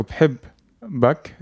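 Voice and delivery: بحبك drawn out slowly as bhibbak with the doubled b; slowly spelled-out Lebanese "I love you"; slow Arabic demo. Shown as a demonstration. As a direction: repeat بحبك slowly for the learner.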